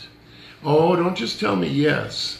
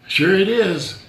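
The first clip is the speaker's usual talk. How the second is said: sarcastic; 6 semitones higher, 5 dB louder, slower than usual. Acted. Sarcastic jab at lawnmower comparison.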